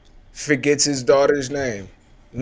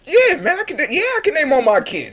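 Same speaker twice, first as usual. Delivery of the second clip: much higher, breezy celebrity voice; [name] impression; 13 semitones higher, faster than usual. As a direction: play it high and overconfident. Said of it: "And Nick".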